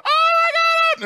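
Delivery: very high, loud screaming exclamation; crowd screaming. Retold or imitated. The concert crowd reacts to the duet, a high, loud scream. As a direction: scream like a stunned fan in the crowd. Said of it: "the whole crowd screaming".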